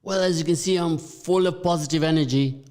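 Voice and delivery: loud, high-pitched, energetic declaration; full of positive energy. Real, not acted. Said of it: "hear it in my voice".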